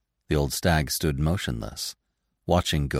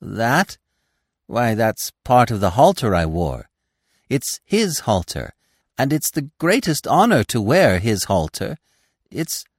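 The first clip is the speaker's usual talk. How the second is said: higher, mildly flustered defence; a touch of nervous pride; uneasy; 6 semitones higher, 4 dB louder than usual. A narrator's reading. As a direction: justify it uneasily, then falter. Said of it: "Gobo answered uneasily".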